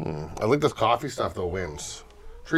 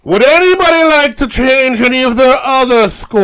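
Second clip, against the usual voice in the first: announcer call; 10 semitones higher, 9 dB louder than usual. Pretend. Calling for final scores.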